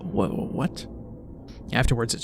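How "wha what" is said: stunned. Real, not acted. Shocked narrator reacting.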